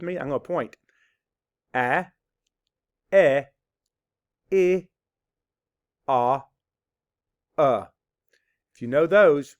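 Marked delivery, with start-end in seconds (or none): 1.7-7.9 A, E, I, O, U as isolated short sounds: ah, eh, ih, aw, uh; each vowel short and separate, long pauses between. Shown as a demonstration. Teacher leading kids through vowels, short isolated sounds. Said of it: "Do you know the short vowel sounds".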